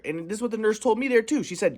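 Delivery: ordinary talk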